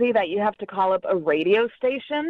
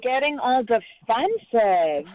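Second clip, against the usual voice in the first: drawn-out, condescending fake sympathy; mocking pity; 3 semitones higher than usual. Real, not acted. Mocking the caller mid-quarrel.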